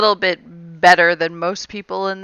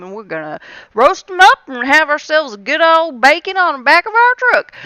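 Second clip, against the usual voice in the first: high, loud small-town country twang; 7 semitones higher, 3 dB louder than usual. Pretend. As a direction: lay on a hammed-up backwoods country accent. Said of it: "start playing with that whole".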